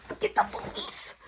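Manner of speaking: urgent voiceless outburst; acted exasperation with the voice dropped out; exasperated interruption. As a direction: cut him off in a strained whisper. Cuts off brother's chatter in panic.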